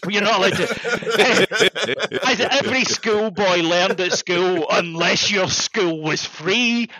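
slow, plummy mock-posh pronouncement; posh schoolmaster. Host mocking table-manner rules, in slow posh voice. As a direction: lay down etiquette like a pompous headmaster.

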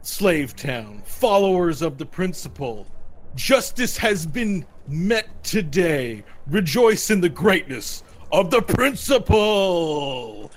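slow, declamatory public announcement; proclaiming magistrate. Acted. Magistrate addresses Slave Town after the fight, slow proclamation. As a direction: proclaim it grandly to a crowd. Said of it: "the magistrate, opens up the door again".

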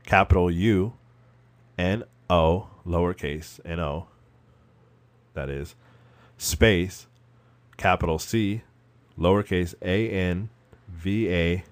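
letter by letter, each one spaced; spelling it out. Shown as a demonstration. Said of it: "Type this in".

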